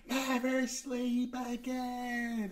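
high, very drawn-out eerie chant; mock spooky child. Co-host imitating the boy from New Nightmare, mock spooky child. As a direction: stretch it out like a creepy kid in a horror film. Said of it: "he'd watch Freddy and go".

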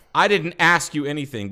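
high, loud imitated rebuke; yelling officer. Officer rebuking the driver he suspects, imitated yell. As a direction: bellow it like an irate cop. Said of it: "He yelled at me and said".

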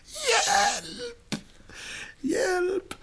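very high, drawn-out wail; hammed-up helpless high cry; crying out for help. A narrator's reading. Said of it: "vänder sig mot väggen och skriker".

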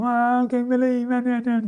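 high, slow, self-pitying moan; mock moping sigh of disbelief. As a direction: whine it like someone sulking in defeat. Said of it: "He's just moping all the time".

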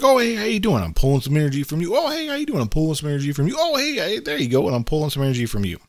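high, fast, sugary mock greeting; a chirpy party vampire sucking energy. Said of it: "I call these the vampires".

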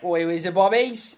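loud mock cockney geezer; hammy East End shout. Joins the cockney routine.

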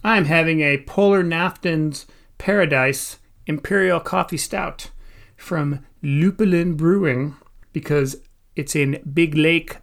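ordinary delivery

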